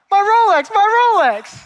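very high, loud comic outcry; frustrated wail. Acted. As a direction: cry out in hammed-up horror at a lost treasure. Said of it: "he's all frustrated".

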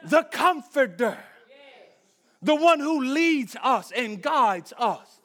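loud, high, ringing preacher's cry; full-force preaching. Acted. Pastor preaching loudly to the church about the Spirit. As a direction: preach it out over the congregation.

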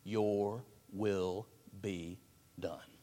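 soft, slow prayer of submission; hushed surrender. Shown as a demonstration. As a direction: sink into it, yielding. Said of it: "Four words that Jesus perfectly lived by".

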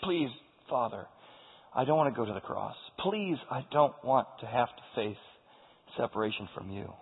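low, soft, hushed plea; subdued, halting entreaty to a father; pleading. Acted. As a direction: beg quietly, as in private prayer. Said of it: "the one that pleaded three times".

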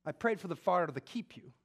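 preacher voicing Jesus reassuring Peter; fervent preaching. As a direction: press the comfort home. Preaching at full force.

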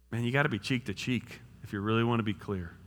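usual speaking voice

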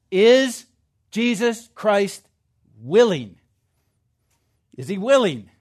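loud, emphatic pulpit delivery with long pauses; preaching at full force. Acted. Preacher urging his brothers and sisters, loud and forceful. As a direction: hammer each line home to the congregation.

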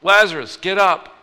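retold shout, punched on each part; yell. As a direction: call him out of the tomb loudly. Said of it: "he yells".

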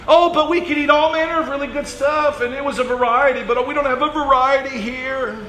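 high, nasal-free mock whine, fast at the end; whining Israelites. Preacher mocks Israelites crying in their tents, high whine. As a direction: whimper like spoiled complainers. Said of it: "they're crying".

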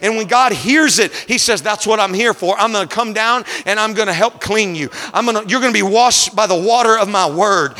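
climbing sermon cadence over the organ; rising pulpit fervor, held strong; preaching at full force. Acted. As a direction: preach it home to the congregation. Preaching to congregation with music.

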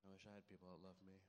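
low, quiet, quick mumbled self-pity; mock-mopey murmur; depressed and emo. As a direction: act out a sulking emo kid under your breath. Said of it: "kinda depressed and emo about it".

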